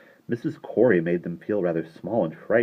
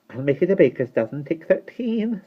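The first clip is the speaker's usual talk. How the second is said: brisk, cheerful, a little higher offer; 11 semitones higher than usual. A narrator's reading. Shopkeeper giving the children an extra cake, cheerful and brisk. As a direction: toss in the extra one generously. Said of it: "said Miss Corey cheerfully".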